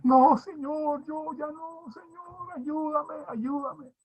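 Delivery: high, pleading, near-sobbing cry to God; retold crying plea. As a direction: beg for help as if in tears, voice cracking high. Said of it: "Y yo lloraba".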